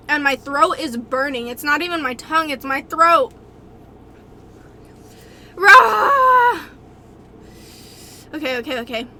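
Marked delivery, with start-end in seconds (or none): 5.5-6.6 loud burst of pain; pained shout. Real, not acted. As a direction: yell it out against the burn. Throat burning from spice.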